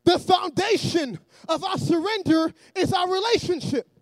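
loud, emphatic sermon delivery; forceful preacher cadence; preaching. Real, not acted. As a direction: preach it to a congregation in measured phrases. Preaches to the congregation.

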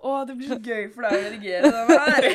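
words breaking up in laughter; laughing. Real, not acted. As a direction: talk through a laughing fit. Bryter sammen i latter. (snakker gjennom latteren)

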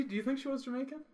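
high mock Jamaican voice; island accent. Imitating the secretary's island accent.

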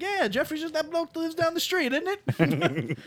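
high-pitched mock British bloke; imitated movie character. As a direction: pitch it up like a silly Brit in a film. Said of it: "He's like".

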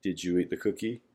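soft, low, knowing repeat; quiet pointed ask. Retold or imitated. Parent to guilty child, soft knowing question. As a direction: let them know you know.

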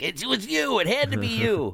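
high, pointed mock accusation; imitating a friend pinning the blame; accusing Eddie. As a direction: jab the blame at him in a squeaky voice. Eddie accusing him, retold.

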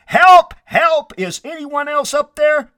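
very high, loud shout; comic desperate yell; yelling for help. Acted. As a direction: call past the first rescuer for anyone else. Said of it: "Finally, Jack yelled".

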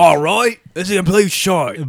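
high, put-on British voice echoing a friend; mock British accent. Podcast host teasing co-host, put-on British accent.

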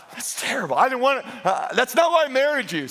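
mock exasperation. Mock protest to wife, for laughs.

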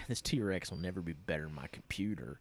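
hushed unvoiced villain line; mock whisper of a creepy kid; whispered quote. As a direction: whisper it like a sinister secret. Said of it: "is like".

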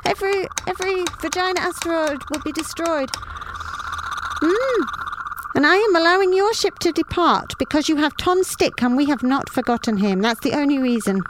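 high, haughty tyrant's speech; pompous villain. A narrator's reading. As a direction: lecture them like a gloating dictator. Villain chief's long speech.

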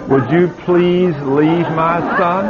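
mock timid plea. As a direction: ask the devil politely, mocking her. Mocking the mother's timid prayer.

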